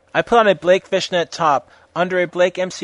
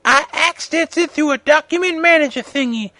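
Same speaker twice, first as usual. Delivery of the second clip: high-pitched, raised character voice; put-on author's note voice; 13 semitones higher, 6 dB louder than usual. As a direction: read it high and animated, in a put-on voice.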